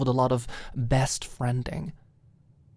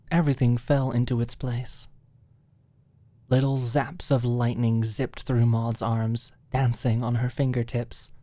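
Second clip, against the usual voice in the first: plain reading voice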